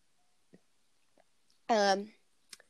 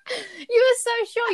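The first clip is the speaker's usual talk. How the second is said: laughing; 13 semitones higher than usual. Real, not acted. Laughing at friend's guess.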